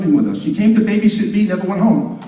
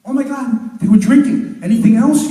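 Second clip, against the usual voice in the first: mock shock; 6 semitones higher, 5 dB louder than usual. Zoo guy translating chimp, shocked.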